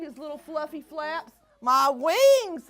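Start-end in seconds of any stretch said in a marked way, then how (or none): 1.6-2.7 louder joyful cry; Beaky finding he can fly; excited cry. Acted. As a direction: shout with delighted surprise. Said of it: "he cried".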